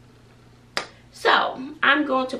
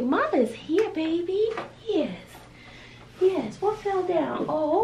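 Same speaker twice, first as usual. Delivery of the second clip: tender motherly coo, sometimes higher still; 6 semitones higher, slower than usual. Real, not acted. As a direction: comfort the little one as Mama. Comforting a baby with Mama.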